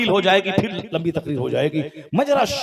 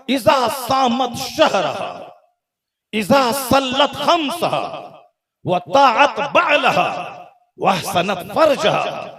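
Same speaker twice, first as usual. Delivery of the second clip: slow, measured Arabic recitation; 4 semitones higher, slower than usual. Acted. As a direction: intone each phrase slowly. (धीमी अरबी तिलावत जैसी अदायगी)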